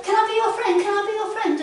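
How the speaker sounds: high, loud pleading little frog; high pleading frog asking to be friends. Acted. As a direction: beg eagerly like a tiny frog. Frog Freddy begs for friends.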